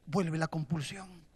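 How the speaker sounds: ordinary delivery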